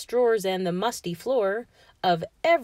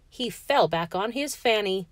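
usual speaking voice

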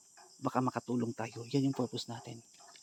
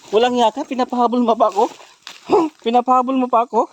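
loud, high-pitched yelling on the chase; angry shout; 11 semitones higher, 18 dB louder than usual. Real, not acted. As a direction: yell indignantly at someone running off. Yelling while chasing runaways. (galit na sigaw habang humahabol)

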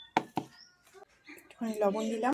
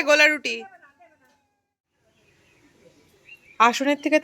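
calling out loud; 6 semitones higher, 9 dB louder than usual. Real, not acted. Calling across house to Aparna, child.

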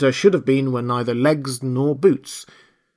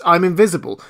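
higher, louder, emphatic declaration; emphatic insistence; 6 semitones higher, 6 dB louder than usual. A narrator's reading. The voice explaining to Marvel, emphatic. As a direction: declare it forcefully.

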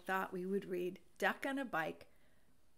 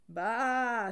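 a louder sheep baa; bleating baa; 7 dB louder than usual. A narrator's reading. Sheep answering Duck with a bleat.

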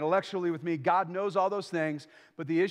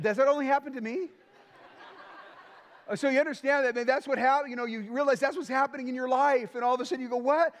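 laughing along; 6 semitones higher than usual. Real, not acted. Laughing at his own story.

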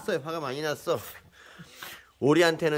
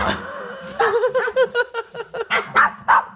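squealing laugh; a long high delighted laugh; high burst of laughter; 4 dB louder than usual. Real, not acted. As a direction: crack up at the dog's trick. Panda climbs up to look.

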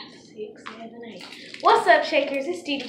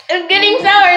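louder, rising alarm at the sourness; excited; 6 semitones higher, 9 dB louder than usual. Real, not acted. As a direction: sound thrilled and alarmed. Candy turning sour now.

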